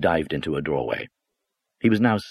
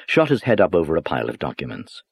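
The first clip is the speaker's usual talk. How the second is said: plain reading voice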